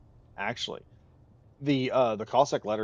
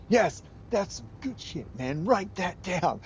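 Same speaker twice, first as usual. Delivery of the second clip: rowdy Cossacks; 10 semitones higher, 6 dB louder, slower than usual. Retold or imitated. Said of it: "laughing their heads off and going".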